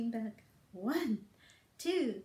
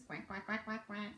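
soft, low playful quacks; silly duckling quacks; 5 semitones lower, 9 dB quieter than usual. Acted. Silly ducklings for the toddlers, soft low quacks.

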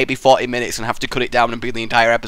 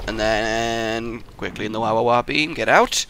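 ordinary delivery

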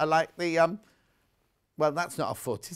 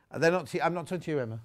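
ordinary delivery

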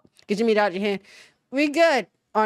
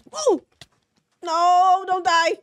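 alarmed plea; 7 semitones higher, 4 dB louder, slower than usual. Real, not acted. Pleading as pet dies.

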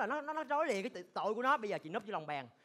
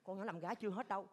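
hushed gossip; 10 dB quieter than usual. Acted. Hushed gossip after blurting.

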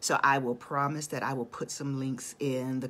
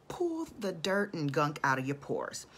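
usual speaking voice

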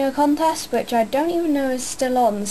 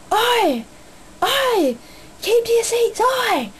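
high-pitched mock guard yelling oi; security guard shouting; 11 semitones higher, slower than usual. Imitating the security guard telling fans to sit down. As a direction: bark it like a bossy steward. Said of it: "the security guard going".

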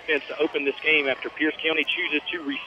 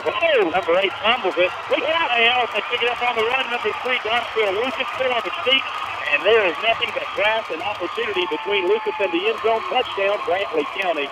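higher, building call of the run; excited play-by-play; 7 semitones higher than usual. Real, not acted. Announcer calling the fumble return, excited and higher. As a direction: ride the run all the way to the end zone.

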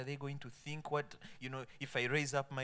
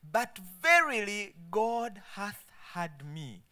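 slow, raised, emphatic verse reading; preaching scripture declamation; 8 semitones higher, 7 dB louder, slower than usual. Acted. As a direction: proclaim each phrase slowly from the pulpit.

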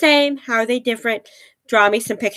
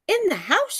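squeak; 10 semitones higher, 4 dB quieter than usual. Acted. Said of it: "squeaked the mouse".

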